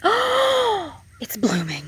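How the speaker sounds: sudden high gasp of delight. Real, not acted. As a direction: gasp with joy at a bloom. Spots blooming flower.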